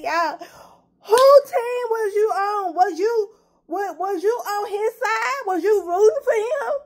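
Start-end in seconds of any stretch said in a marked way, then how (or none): 1.0-6.7 tearful plea. Real, not acted. Fan tearfully asking viewers whose side they took. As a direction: beg them to say they were on his side. Pleading viewers, near tears.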